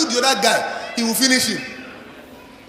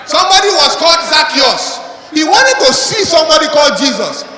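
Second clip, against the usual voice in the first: raised, louder sermon voice; fiery preaching; 5 semitones higher, 3 dB louder than usual. Acted. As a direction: drive it home to the congregation. Preaching at full force.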